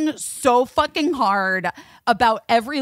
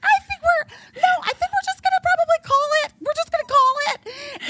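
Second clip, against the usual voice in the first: much higher, hurried, nervous bail-out; high flustered self-quote; faster than usual. Retold or imitated. Mother to bouncers, declining politely, high and flustered. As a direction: squeak out the excuse fast, embarrassed. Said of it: "And I was like".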